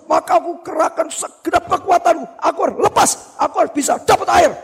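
high-pitched urgent resolve; acted fight for survival; desperate determination. Boy recounts struggle for air.

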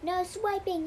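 loud, high yell to stop the thief. A narrator's reading. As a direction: shout it to stop a swiper. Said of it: "yelled Dora".

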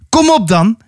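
high-pitched, contained dare; shouting. Acted. Atta challenges the mammoth, acted high shout. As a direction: cry out a challenge without much volume. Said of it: "schreeuwde ze".

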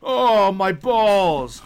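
loud, high, drawn-out fake groan; milk it like a kid faking injury; mock pained wail. Dad imitating stepson bending over, mock pained wail. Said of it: "He has to bend over and go".

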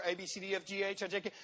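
quick mock skimming of a list; rattled-off list. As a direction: race through the letters dismissively, without pauses. Mimics books racing through the list.

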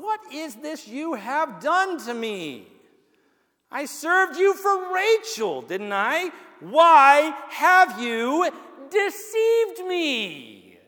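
high, slow, pleading accusation; drawn-out outraged question; indignant. Acted. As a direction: confront the man who tricked you. Jacob confronts Laban who deceived him.

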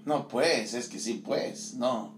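ordinary talk, nothing marked

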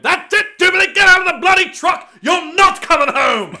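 very high, loud English yell, laughing at the end. Retold or imitated. Ordering food, yelling at the friend Jubilee to get out, in a loud English accent. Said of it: "I'm just yelling at this point".

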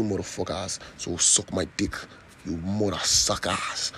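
mock-defiant African accent; put-on African accent. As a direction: ham up an African accent to prove you have one. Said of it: "supposedly I don't have an accent".

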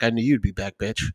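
low, soft taunting cat voice; menacing cat. Pretend. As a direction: purr it as a smug villain cat. Voicing the cat.

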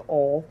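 "ol" for oil, glide dropped; imitated Southern pronunciation. Mimicking her mom's word for oil, Southern "ol". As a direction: say oil as "ol", one flat vowel. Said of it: "your mom says".

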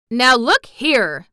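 loud, slow sharp rebuke. A narrator's reading. Gardener sharply stops Mary's questions, loud. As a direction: cut her off sharply. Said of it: "he said sharply".

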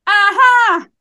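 high, loud mock exclamation; loud nurse. Nurse confirms the weight out loud, mock yell. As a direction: bark it out across the room. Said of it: "y ella a toda boca".

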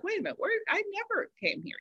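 quick, high, hushed double take; startled, rattle it off softly; mock surprise. Retold surprise at changed LA.

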